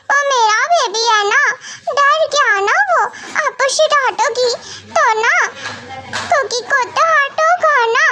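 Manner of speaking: put-on high little-girl voice, half laughing, half crying; a small child sniffling through her explanation; childlike teary pleading. (ऊँची बच्चों जैसी आवाज़, आधी हँसी आधा रोना)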